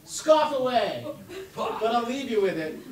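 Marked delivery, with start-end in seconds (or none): 0.0-1.0 loud, slow, high call to scoff; loud slow playful challenge; playful dare. Real, not acted. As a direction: dare them with a grin. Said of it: "you may scoff".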